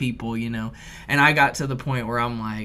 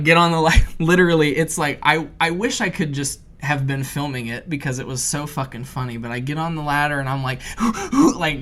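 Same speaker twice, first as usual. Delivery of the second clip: ordinary delivery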